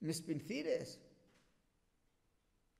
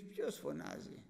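whispered, slow, low question; the Lord asking gently; 13 semitones lower, 6 dB quieter than usual. Acted. The Lord asking the crowd who calls, whispered. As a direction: hush it, calm and unhurried. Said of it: "Και λέει ο Κύριος".

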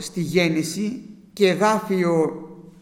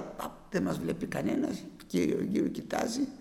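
whispered, much softer, furtive; sneaky whisper; 9 dB quieter than usual. Acted. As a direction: hush it like someone afraid of being seen. Cain hiding the body, fearful. (ψίθυρος)

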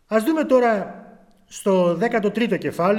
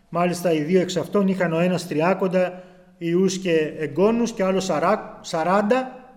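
usual speaking voice

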